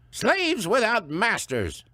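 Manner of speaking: high, slow matriarch voice; stern elder woman; stern old woman. Acted. Missus Gudrun's voice.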